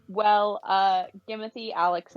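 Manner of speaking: ordinary talk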